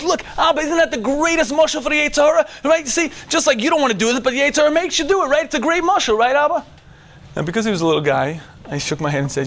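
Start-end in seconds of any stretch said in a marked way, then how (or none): 0.0-2.8 put-on high, bright-eyed kid voice, excited; eager young son. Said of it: "Shalom Ari goes". 2.8-6.6 put-on high, bright-eyed kid voice, excited; eager young son. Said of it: "Shalom Ari goes".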